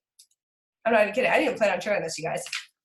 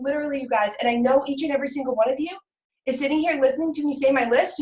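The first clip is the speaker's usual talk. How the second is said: ordinary delivery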